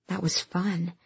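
softly, as a private thought; quiet inner thought. A narrator's reading. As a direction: murmur it to yourself.

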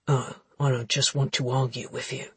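soft, low insinuating man; low suggestive voice. Acted. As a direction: seductive low murmur.

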